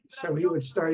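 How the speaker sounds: normal talking voice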